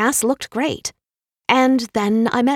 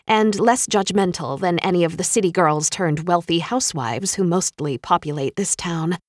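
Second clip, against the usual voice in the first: plain reading voice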